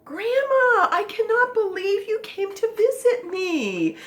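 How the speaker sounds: high-pitched thrilled squeal at seeing Grandma; all excited. Acted. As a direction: greet Grandma with overjoyed excitement. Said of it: "And all excited to see Grandma".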